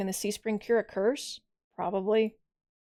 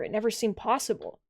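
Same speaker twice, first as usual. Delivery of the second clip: normal talking voice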